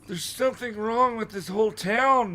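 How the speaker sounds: high, slow character voice; high-pitched old man; Mister Dawes. A narrator's reading. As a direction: put on a high, drawn-out voice. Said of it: "Mister Dawes said".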